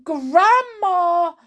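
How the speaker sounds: high, loud shriek of alarm; high-pitched alarmed yell. A narrator's reading. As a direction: shriek out to her in panic. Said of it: "shrieked Missus Cranky".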